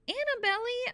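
high-pitched calling scream. A narrator's reading. Stuck boy calls Annabelle, screaming high. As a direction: call the name desperately high. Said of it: "I screamed".

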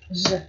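"Z" as a lone buzzing zzz; voiced Z sound. Shown as a demonstration. Teacher shows the ending after V, voiced Z. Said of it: "phát âm nó là âm Z".

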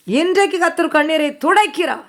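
high, loud proclamation; impassioned preaching. Real, not acted. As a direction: cry out today's promise at full force. Preacher climaxes the promise. (ஆவேசமான உரத்த பிரசங்கம்)

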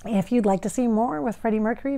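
ordinary talk, nothing marked

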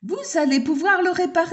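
the mayor anxiously asking the handyman for help. Acted. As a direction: blurt it out hoping he can fix it.

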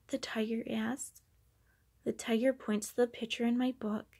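usual speaking voice

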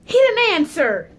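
loud, high, drawn-out complaint; exclaiming. Real, not acted. Prank call goes unanswered.

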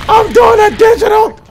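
hyped shout. Real, not acted. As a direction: shout it out in triumph. Hyped mid killstreak.

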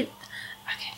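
breathy whispered okay; just whisper it. Real, not acted. Handing over controller.

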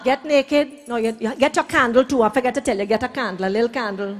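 ordinary talk, nothing marked

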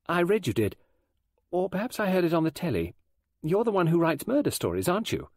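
put-on high young girl's voice; pushy schoolgirl; forward thirteen-year-old girl. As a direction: question the guest boldly, pitched high.

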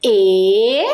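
"E" said slowly and clearly alone; sounding out "E" for kids; vowel sound demo. Shown as a demonstration. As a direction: isolate the sound "E". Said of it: "Olha o som".

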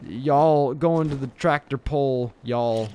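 slow, stretched Southern drawl; hammed-up Southern accent, drawn right out. Shown as a demonstration. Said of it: "Do a southern accent".

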